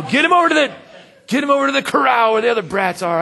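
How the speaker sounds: loud, high-pitched comic shouting; hammed-up irritated yell; mock rude usher. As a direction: shoo kids off like a cranky usher. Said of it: "no, that's not how we talk".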